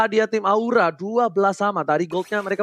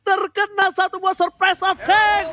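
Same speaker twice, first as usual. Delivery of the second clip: excited commentator shout; 11 semitones higher than usual. Acted. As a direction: yell the outplay to the crowd. Hyped play-by-play of an outplay. (teriakan komentator yang heboh)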